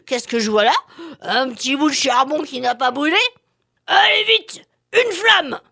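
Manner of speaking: laughing chimney, then a loud call; a sly chimney, chuckling. Acted.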